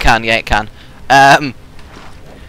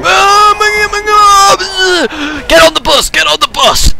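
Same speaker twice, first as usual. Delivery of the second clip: panicked screaming; 5 dB louder, slower than usual. Real, not acted. Zombie chase, yelling at friends.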